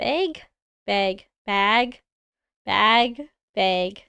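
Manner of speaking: "bag" repeated, vowel wavering between beg and bag; trying out "bag". Shown as a demonstration. Streamer trying to change her accent, halting "bag" attempts. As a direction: test "bag" over and over, one word at a time. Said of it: "it just feels wrong".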